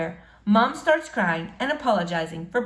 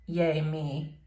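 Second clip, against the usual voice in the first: plain reading voice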